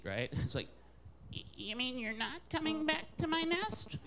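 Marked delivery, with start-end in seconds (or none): 1.3-4.0 high, pleading crow voice; high-pitched, softly dejected bird; defeated crow. Acted. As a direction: plead as a hammed-up loser crow. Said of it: "His call actually admits defeat".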